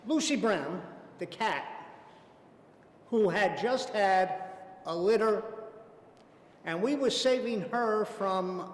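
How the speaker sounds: usual speaking voice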